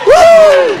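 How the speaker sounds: high whooping cheer; fan's cheer; sports fan whoop. Pretend. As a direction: whoop like at an Eagles game. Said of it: "exaggerating it for comedic effect".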